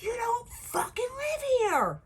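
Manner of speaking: high, loud cracking scream; terrified shout. Retold or imitated. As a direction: scream it back in panic. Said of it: "And I'm like".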